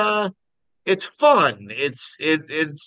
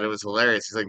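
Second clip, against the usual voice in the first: normal talking voice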